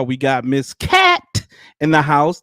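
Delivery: usual speaking voice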